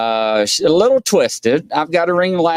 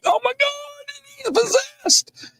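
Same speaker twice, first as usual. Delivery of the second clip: mock fright; 4 dB quieter, slower than usual. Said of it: "they were like".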